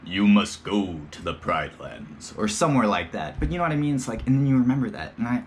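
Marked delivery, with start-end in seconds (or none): 0.0-2.3 slow grave lion king speech; James Earl Jones voice. Retold or imitated. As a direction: imitate a deep regal film father, slowly. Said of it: "his James Earl Jones voice".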